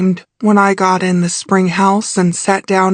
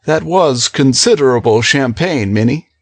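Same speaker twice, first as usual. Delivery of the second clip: male character voice; 7 semitones lower than usual. A narrator's reading.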